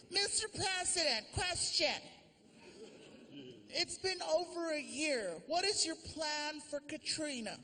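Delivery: high, thin press-corps voice; reporter voice. Acted. Reporter questioning the president about Katrina, high. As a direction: play a nervous reporter asking.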